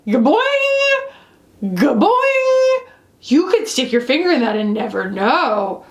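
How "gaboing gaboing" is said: high springy "gaboing"; high, drawn-out boing sound effect; cartoon boing noise. Imitating the bouncy texture.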